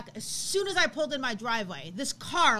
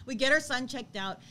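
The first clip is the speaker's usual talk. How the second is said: ordinary talk, nothing marked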